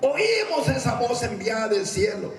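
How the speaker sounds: high, slow, declaimed reading; scripture proclamation. Acted. Preacher reads Peter to congregation, high declamation.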